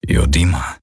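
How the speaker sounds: low, hopeless creature rumble; weary growl. A narrator's reading. Hocks answers Kirai, growling low and hopeless. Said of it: "brummte Hocks".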